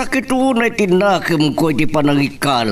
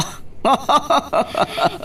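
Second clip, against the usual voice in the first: a gleeful, mocking laugh pitched way up; mocking high laugh; 3 dB quieter than usual. New wife laughs in triumph at the husband's ex: mocking high laugh. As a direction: cackle at the rival's misfortune. Rival gloats over ex-wife.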